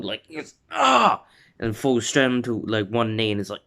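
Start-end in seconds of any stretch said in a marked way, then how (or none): 0.7-1.2 high, loud cry on impact; short acted scream of pain; pained yell. Fan acting Bakugo hitting the wall, high pained yell.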